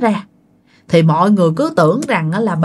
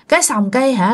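ordinary delivery

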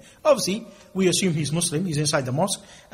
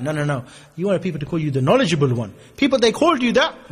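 normal talking voice

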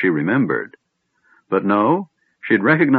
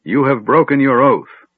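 loud, higher stern accusation; demanding; 6 semitones higher, 5 dB louder than usual. A narrator's reading. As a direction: accuse her with full authority. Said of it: "Fizban demanded".

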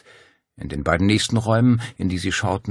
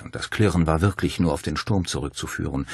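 ordinary delivery

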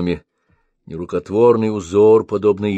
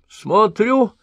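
put-on high old woman, offhand and unbothered; indifferent; 13 semitones higher, 4 dB louder than usual. A narrator's reading. Old woman watches him sink, mild indifferent reply. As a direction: shrug it off. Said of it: "равнодушно ответила она".

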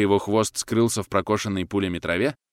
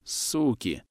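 softer pained curse; groaning in pain; 5 dB quieter than usual. A narrator's reading. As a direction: groan it through a wound, quietly. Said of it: "простонал он". (тихо простонать от боли)

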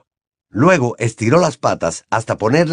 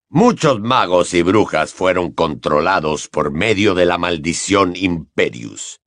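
low-pitched, measured, grave explanation; grave teacher; 6 semitones lower than usual. A narrator's reading. Moody tells the class about past victims, grave.

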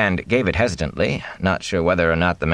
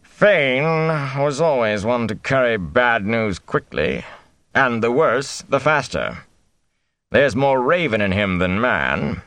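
scornful; 6 semitones higher, slower than usual. A narrator's reading. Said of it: "replaced by scorn".

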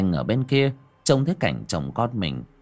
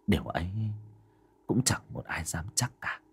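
whisper; 3 semitones lower, 7 dB quieter than usual. A narrator's reading. Eerie ghost story narration.

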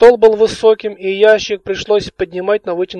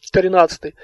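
ordinary delivery

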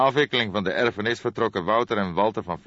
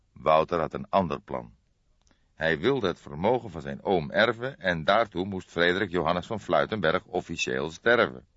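ordinary delivery, nothing marked

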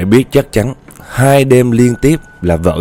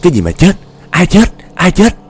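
stammering in fear; 6 semitones higher than usual. Retold or imitated. Husband hearing of a death omen, stammering then crying out. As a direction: stumble over the words, fear climbing until you almost shout. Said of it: "anh mới lắp bắp á".

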